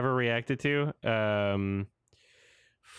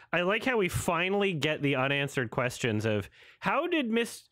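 normal talking voice

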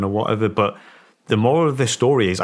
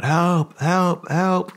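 mock cries of help, pitched way up; imitating screams for help; 9 semitones higher, much slower than usual. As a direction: pitch it high like a girl calling for help across the road. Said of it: "I can hear this girl screaming".

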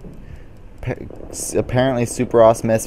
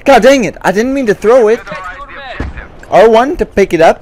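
higher, louder real frustration; frustrated outburst; 9 semitones higher, 4 dB louder than usual. Real, not acted. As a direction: yell at the game after the misthrow. Frustrated outburst after misthrow.

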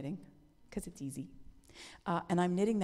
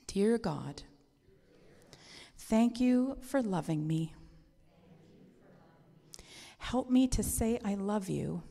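put-on slow, measured prayer for kids to echo; children's prayer; 2 semitones lower than usual. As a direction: pray slowly, phrase by phrase, for children to repeat. Leads children in repeated prayer.

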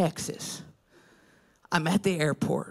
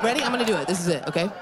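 normal talking voice

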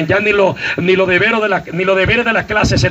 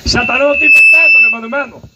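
loud, high preaching cry; loud warning; 6 semitones higher, 5 dB louder than usual. Real, not acted. Preacher warning the church, loud and high.

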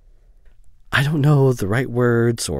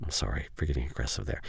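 much softer, lower and quicker; subdued apology; 6 semitones lower, 11 dB quieter, faster than usual. Acted. Apologizing to a friend after getting forceful, subdued. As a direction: drop to a quick, quiet apology.

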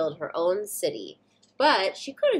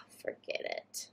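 breathy unvoiced whisper; 14 dB quieter, slower than usual. Satya giving up on the slide, whispered. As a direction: give up under your breath. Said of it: "She says".

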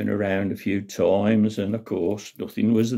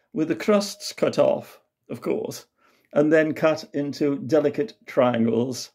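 higher-pitched posh lady butting in; posh Countess; 4 semitones higher than usual. Acted. Countess butting in about Rupert's sandwiches, posh and high. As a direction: play the toffee-nosed Countess, pitched up. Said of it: "the Countess Esmeralda butted in with".

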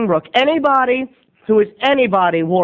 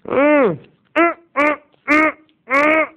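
high pleased mm sounds; savoring mm; 8 semitones higher, slower than usual. Real, not acted. Tasting a chocolate espresso bean, high happy hums. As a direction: hum with delight over the candy.

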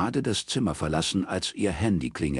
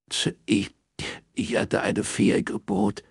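high, halting tearful voice; acted crying, pitched high; heartbroken sobbing; 5 semitones higher than usual. A narrator's reading. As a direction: stammer it out through sobs. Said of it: "Ein herzzerreißendes Schluchzen drang aus dem Hörer".

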